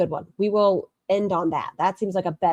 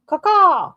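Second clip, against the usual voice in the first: high loud caw; bird call; 12 semitones higher, 6 dB louder than usual. Pretend. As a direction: squawk like a bird. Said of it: "This is a mating call".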